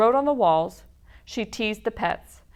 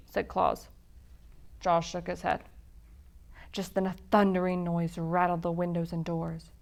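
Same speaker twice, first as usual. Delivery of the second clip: usual speaking voice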